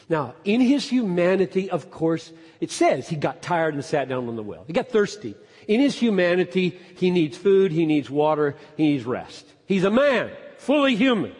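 punchy, loud sermon delivery; emphatic, driving preacher; forceful preaching. Real, not acted. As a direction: hammer out each short clause from the pulpit.

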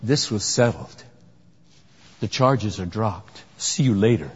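low, soft, unhurried judge's verdict; calm judge. Retold or imitated. Preacher voicing a judge dismissing the charges, low and soft. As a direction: settle it quietly, case closed. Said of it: "Justice Thomas says".

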